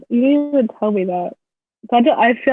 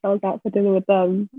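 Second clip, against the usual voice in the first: normal talking voice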